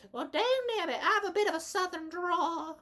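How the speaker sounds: put-on very high southern belle accent; southern drawl. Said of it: "She has a bit of a southern drawl".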